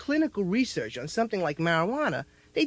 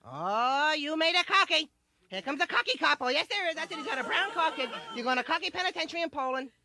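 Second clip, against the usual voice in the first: put-on higher, cooing baby talk; doting mom; 7 semitones higher than usual. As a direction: coo at the toddler like a proud mom. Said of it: "My mom was a freak for that".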